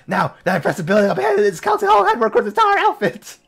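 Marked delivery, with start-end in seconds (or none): 1.2-3.4 high loud mock reading; high, loud hammed-up reading; excited mock read. Real, not acted. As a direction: belt out the game text for laughs.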